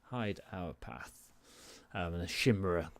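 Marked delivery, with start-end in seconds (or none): 0.0-1.3 quiet, slow incantation; a hushed thief casting an invisibility spell; Torvil's spell words. Acted. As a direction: murmur the spell softly and slowly. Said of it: "and kind of says".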